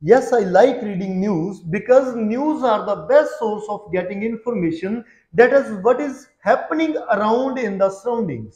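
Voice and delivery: measured, evenly paced model answer; steady pace. Shown as a demonstration. Teacher showing students the right exam answer, steady pace. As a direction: keep the answer moving at an even pace. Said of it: "ਸਟੈਡੀ ਪੇਸ ਰੱਖੋ".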